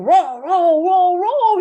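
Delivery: big high playful roar; loud roar. Acted. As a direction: roar loudly like a tiger. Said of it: "with one loud roar".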